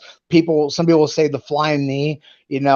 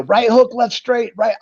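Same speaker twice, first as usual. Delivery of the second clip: higher-pitched imitation of his wife; wife calling out corner instructions; 8 semitones higher than usual. Fighter imitating his wife's ringside calls, pitched higher. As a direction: call fight cues in a raised pitch, imitating her. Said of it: "I could always hear my wife".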